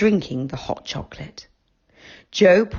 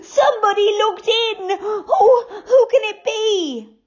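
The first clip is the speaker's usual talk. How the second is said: put-on high, crying, insistent girl; scared girl insisting she saw someone; frightened Goldilocks. A narrator's reading.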